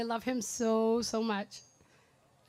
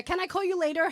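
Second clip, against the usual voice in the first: "Can I call you later?" mocked, higher and louder; mocking brother's text; 6 semitones higher, 5 dB louder than usual. Sister teasing brother at his birthday, mocking his stock reply. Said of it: "he'll text me back that message".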